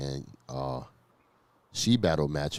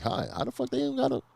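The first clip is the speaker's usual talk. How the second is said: squeaky astonished crowd voice; shocked high squeal. Retold or imitated. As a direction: shriek it in amazement. Crowd reaction quoted.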